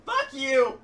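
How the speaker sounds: loud laughing curse; laughing mock outrage. Real, not acted. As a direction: yell it while cracking up.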